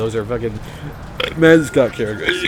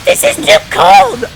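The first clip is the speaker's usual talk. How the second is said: much higher, much louder, slow hammed-up shriek; mock shrieking customer; 9 dB louder, much slower than usual. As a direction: scream the complaint like a diva. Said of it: "then she's like".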